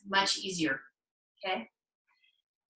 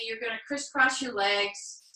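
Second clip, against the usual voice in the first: normal talking voice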